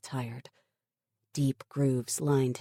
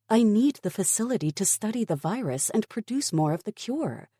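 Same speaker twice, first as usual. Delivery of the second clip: higher, urgent plea; desperate; 8 semitones higher, 4 dB louder, faster than usual. A narrator's reading. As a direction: press him urgently. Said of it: "her desperation rising".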